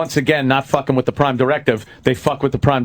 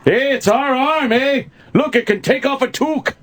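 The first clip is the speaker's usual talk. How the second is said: put-on Canadian accent; 10 semitones higher than usual. Mocking Canadians bragging about their arm, in a put-on Canadian accent. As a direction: ham up the Canadian eh.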